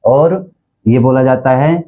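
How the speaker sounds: ordinary delivery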